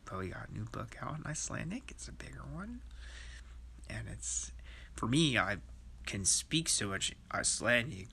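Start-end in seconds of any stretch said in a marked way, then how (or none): 0.1-4.5 hushed, conspiratorial whisper; secretive whisper. Real, not acted. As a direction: whisper it like you're sharing a secret. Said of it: "I don't wanna mention any names".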